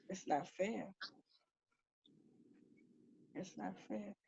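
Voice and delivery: quiet appeal to the spirit, dying away; soft pleading. Real, not acted. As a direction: plead with him gently.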